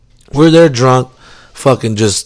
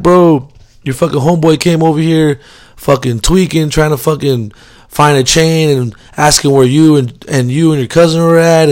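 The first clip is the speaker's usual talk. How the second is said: excited friend; 6 semitones higher than usual. Retold or imitated. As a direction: rattle it off, freaked out. Friends tell him, worked up.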